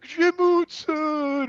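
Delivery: high, long drawn-out cry of a name; acted overjoyed old relative; grandfather's delighted greeting.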